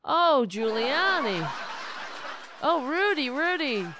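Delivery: high, loud, sarcastic guffawing delivery; hammed-up amusement, slightly drawn out; mock hilarity. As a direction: pretend the joke has you in stitches.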